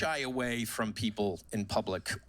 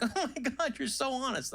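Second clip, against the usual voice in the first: high, gushing cashier; impressed cashier; 12 semitones higher than usual. Retold or imitated. Cashier praising the shopper, high and gushing. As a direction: gush over his honesty. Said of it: "the woman's like".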